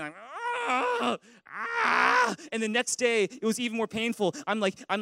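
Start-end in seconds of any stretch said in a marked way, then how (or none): 0.7-2.4 high strained cries of pain; mock yelps from sore muscles; hammed-up screech of pain. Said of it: "I'm, like, screeching in pain".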